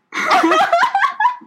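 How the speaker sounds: very high squealing laugh; shrill laugh. Real, not acted. Laughing at grandpa's new catchphrase.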